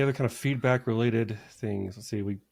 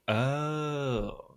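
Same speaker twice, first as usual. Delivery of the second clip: long, slowly drawn-out oh; dawning realization; 4 semitones higher, 4 dB quieter than usual. Acted. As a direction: let the penny drop on a stretched oh. Acts out sudden rubber-duck realization.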